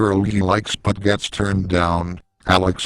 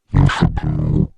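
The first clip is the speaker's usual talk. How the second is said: lower, louder monster bark; dragon snap; 4 semitones lower, 3 dB louder than usual. Acted. The dragon teacher barks back at Spence in a low monster voice. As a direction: bark it down at him like a beast. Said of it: "She shouts".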